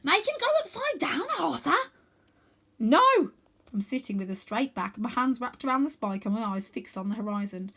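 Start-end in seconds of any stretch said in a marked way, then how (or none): 0.0-1.8 very high eager pleading; eager urging. Acted. Wynne eager. 2.8-3.3 high, loud no; scared boy refuses; firm refusal. Acted. Refusing firmly.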